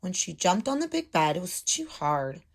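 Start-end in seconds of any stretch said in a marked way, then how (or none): none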